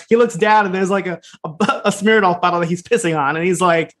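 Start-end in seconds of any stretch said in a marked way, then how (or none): none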